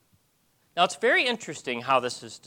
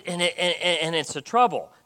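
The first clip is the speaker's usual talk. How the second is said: ordinary talk, nothing marked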